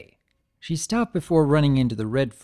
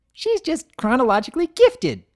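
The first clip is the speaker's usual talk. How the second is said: bubbly joke; 13 semitones higher, 6 dB louder than usual. Acted. Pinkie's joke.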